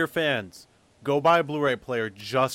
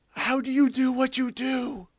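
awestruck fan; 12 semitones higher, slower than usual. Pretend. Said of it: "Jason DeMarco is really the one I'm like".

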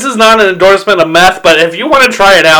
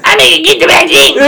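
mock voice; 12 semitones higher than usual. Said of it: "He's like".

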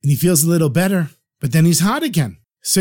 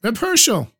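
mild raised call across the room; 6 semitones higher than usual. Retold or imitated. Rebbe calling across a packed warm room, mildly raised call. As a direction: call his name from far inside the house. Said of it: "He shouts to him".

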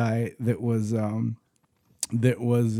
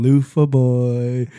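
very slow, drawn-out singsong taunt; mocking taunt; 5 dB louder, much slower than usual. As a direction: tease him with each word stretched out. Sergeant taunting during push-ups.

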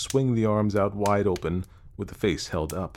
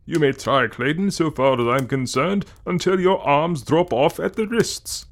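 higher, louder, emphatic put-on voice; confident sceptic Evans; 6 semitones higher, 5 dB louder than usual. A narrator's reading. Evans scoffs at danger to Clayton, loudly confident. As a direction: declare it forcefully, pitched well up.

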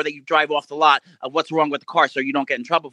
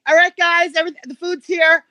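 high, loud call to an imaginary crowd; calling pretend family; 12 semitones higher, 4 dB louder than usual. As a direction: announce pizza to fake housemates. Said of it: "pretending I have a daughter".

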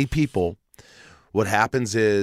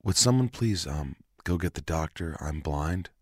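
softer, lower, frightened request; a frightened patient quietly asking for a doctor; scared quiet plea; 5 semitones lower, 6 dB quieter than usual. Retold or imitated. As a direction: ask quietly, holding back panic. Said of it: "And I go".

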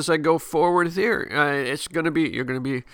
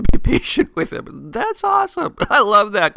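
amused, delighted laughter through the words; laughing; 7 semitones higher, 5 dB louder than usual. Real, not acted. Podcast host tickled by God's patience with Noah, laughing. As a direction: let the laugh lift your voice higher. Amused by Noah's slow learning.

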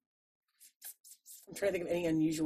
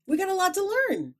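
pitched way up, mock profound; a put-on lofty sage voice; high mock-wise voice; 12 semitones higher, 5 dB louder than usual.

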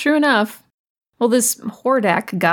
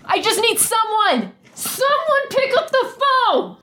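high, drawn-out panicked plea; desperate cry for help; 12 semitones higher, slower than usual. Acted. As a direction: call out for anyone, pitched high. Desperate calling out.

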